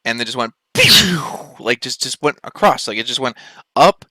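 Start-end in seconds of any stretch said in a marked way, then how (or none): 0.7-1.2 sharp, high, loud zap noise; sound effect pew. Retold or imitated. Describing the UFO darting away, high loud pew. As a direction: fire off a quick laser pew. Imitating the light shooting off.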